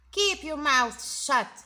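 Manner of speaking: loud stern command; harsh teacher. Acted. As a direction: order them to silence. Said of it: "said Miss Swamp".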